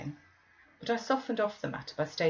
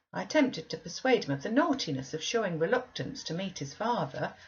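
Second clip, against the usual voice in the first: ordinary delivery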